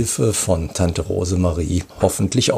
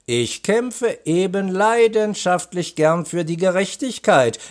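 high, animated woman's character voice; smiling commissioner; 8 semitones higher than usual. A narrator's reading. Commissioner explains her love of the job, smiling. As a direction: say it high, with a smile. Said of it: "lächelte die Kommissarin".

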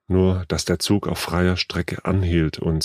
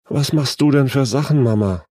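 higher-pitched worried question; concerned daughter; 7 semitones higher, 3 dB louder than usual. A narrator's reading. Daughter tends to her ailing mother, concerned. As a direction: gently chide with concern. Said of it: "blickte besorgt auf ihre Mutter".

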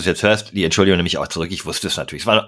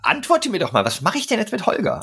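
raised pitch, urgent pleading; high agitated pleading; 8 semitones higher than usual. Real, not acted. Agitated pleading about Holger.

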